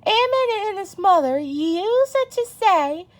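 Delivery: plain reading voice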